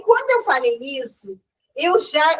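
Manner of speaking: normal talking voice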